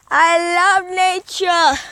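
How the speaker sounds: slow, stretched-out declaration; hammed-up slow gush; drawn-out gushing. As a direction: hold the vowels long. Hammed-up gushing over nature.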